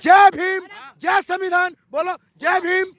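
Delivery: punchy street-protest chant; slogan shouting. Real, not acted. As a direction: call out each slogan for the crowd to echo. Leading a political slogan in argument.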